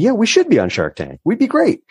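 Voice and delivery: high, eager and pumped up; excited. Retold or imitated. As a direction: gush with sudden confidence. Retelling their own eager reaction.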